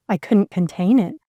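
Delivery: ordinary delivery, nothing marked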